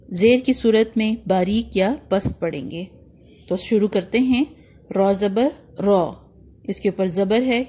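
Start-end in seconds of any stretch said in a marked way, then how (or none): 4.9-6.1 "रॉ" said thick and heavy with the tongue back raised; slow, heavy "रॉ" for a Quran lesson; heavy full R. Shown as a demonstration. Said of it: "मोटा और पुर पढ़ेंगे".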